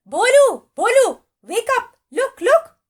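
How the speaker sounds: high, loud excited shouting; excited call. Acted. Wife excitedly calling husband to see the birds, high and loud. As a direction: call him out of sleep, thrilled. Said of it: "she called excited".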